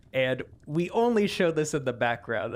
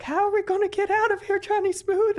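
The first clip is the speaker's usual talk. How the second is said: fast, much higher frantic plea; panicked high voice; 4 dB louder than usual. Acted. Trapped among skeletons, she pleads high and fast. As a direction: plead for escape in a high rush. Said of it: "And he's like".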